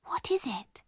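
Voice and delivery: soft, hushed question; whispered. A narrator's reading. Sara peering into the dark attic, whispering. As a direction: barely voice the question. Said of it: "Sara whispered".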